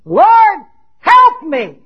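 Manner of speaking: loud, high cry for help. Acted. Canaanite woman still begging Jesus for help, crying out. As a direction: call out each phrase as an urgent plea. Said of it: "she's crying out after Jesus".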